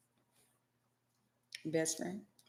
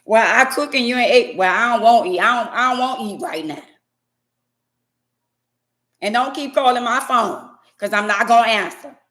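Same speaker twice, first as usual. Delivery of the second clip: louder, snippy back-and-forth; phone argument; 4 semitones higher, 5 dB louder than usual. Acted. Spouses bickering on the phone, snippy loud exchange.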